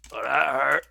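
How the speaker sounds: husky creature remark, understated; wounded monster voice. Acted. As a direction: speak as a barely bothered monster. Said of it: "looks at you and goes".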